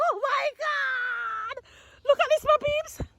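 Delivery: high, slow, half laughing; real delighted cry; overjoyed squeal. Real, not acted. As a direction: gasp in disbelief at the flowers. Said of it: "I am so excited!".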